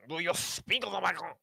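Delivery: loud, high French chef voice. Pretend. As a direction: shout it in a thick French accent. Mocking the French.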